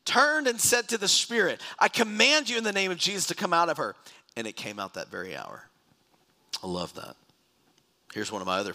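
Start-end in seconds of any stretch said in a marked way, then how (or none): 0.1-4.0 high, loud commanding proclamation; raised voice of spiritual authority; forceful scripture reading. Acted. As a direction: preach it as an exorcist's order. Reads exorcism command from scripture.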